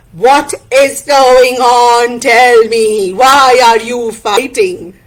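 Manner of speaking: slow, loud village elder; commanding elder's questioning; Tayu, stern elder. Acted. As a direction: demand an explanation, stretching the words.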